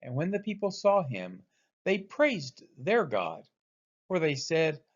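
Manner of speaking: ordinary delivery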